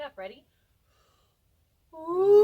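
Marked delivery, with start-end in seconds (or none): 1.9-2.4 a loud siren slide on ooh, sweeping through the range; vocal siren. Shown as a demonstration. Teacher demonstrating a vocal siren to the class, loud sliding ooh. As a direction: sing ooh from the top of your voice down to the bottom. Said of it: "start our voice really high and go all the way down".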